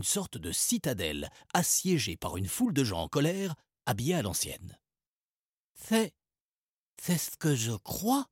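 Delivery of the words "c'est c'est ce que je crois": put-on halting, slow boy's voice, higher; lightly voiced stammering boy; stammering Salim. A narrator's reading. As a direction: stammer it out, unsure. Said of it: "balbutia Salim".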